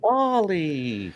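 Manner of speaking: high, drawn-out little robot voice; Wall-E. Acted. Co-host echoes the movie title, imitating the robot. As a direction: imitate the movie robot saying its own name. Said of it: "you remember that movie Wall-E".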